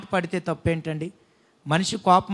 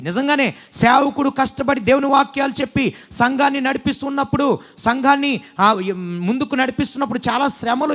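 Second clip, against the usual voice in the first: louder preaching build; rising sermon; 3 semitones higher, 3 dB louder than usual. Acted. Pastor urging the congregation toward patience, preaching loud. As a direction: drive the point home to the church.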